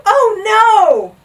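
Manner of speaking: loud high drawn-out cry; horrified gasp. A narrator's reading. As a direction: cry out in horror. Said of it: "gasped Bartholomew".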